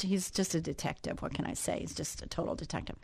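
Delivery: soft whispered aside. Real, not acted. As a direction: drop to a breathy confiding whisper. Proud aside about his son.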